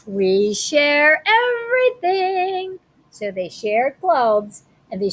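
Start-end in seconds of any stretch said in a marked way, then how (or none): 0.1-2.8 high, slow kindergarten teacher voice, drawn out; sing-song teacher. Acted. Teacher scolding the fighting kids, high and sing-song. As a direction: preach sharing like a cheery kindergarten teacher.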